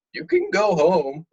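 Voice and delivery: mock dismissive parent. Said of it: "you were like".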